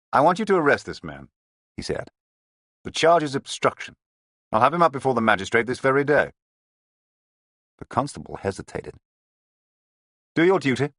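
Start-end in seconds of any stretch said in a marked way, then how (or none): 0.1-6.3 loud, high firm command; commanding order. A narrator's reading. Detective ordering the constable to arrest the gang boss, commanding. 10.3-10.9 loud, high firm command; commanding order. A narrator's reading. Detective ordering the constable to arrest the gang boss, commanding.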